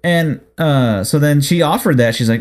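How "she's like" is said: ordinary talk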